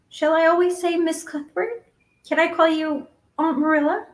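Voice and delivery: put-on high, questioning girl's voice; curious child seeking permission; eager young Anne. A narrator's reading. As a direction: ask hopefully, pitched well up.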